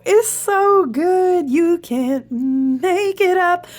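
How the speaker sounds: amazed. Real, not acted. Marveling to viewers at the card, slow and high. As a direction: savour each word in wonder.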